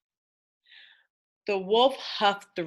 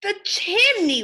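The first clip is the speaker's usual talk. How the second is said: wolf's realization; 10 semitones higher, 6 dB louder than usual. Acted. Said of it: "whispered the wolf".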